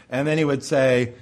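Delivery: ordinary delivery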